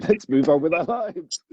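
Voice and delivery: breezy, brushing it off with a laugh; dismissive. Retold or imitated. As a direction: cheerfully shut the critic down. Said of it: "I'm like".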